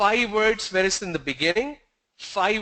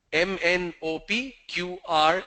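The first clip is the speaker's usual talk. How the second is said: normal talking voice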